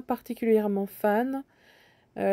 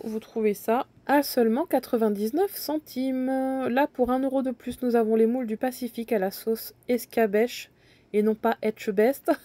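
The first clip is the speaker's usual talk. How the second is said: ordinary talk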